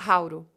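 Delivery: "hauro" as a single word; connected speech. Shown as a demonstration. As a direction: model "hauro", R in place of T. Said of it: "Tenta falar".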